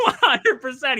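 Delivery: high, loud, through a laugh; giggling one hundred percent; laughing agreement. Real, not acted.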